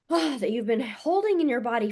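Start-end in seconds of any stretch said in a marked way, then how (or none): 0.1-0.4 audible sighed "ah", higher-pitched; heavy sigh. Real, not acted. Coach talking of pain held for years, heavy sigh. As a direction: let the breath out on an ah.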